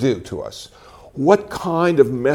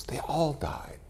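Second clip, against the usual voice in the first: whisper; 14 dB quieter, slower than usual. Real, not acted. Grave news about dying patients.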